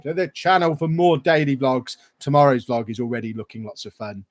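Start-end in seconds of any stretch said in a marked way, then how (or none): none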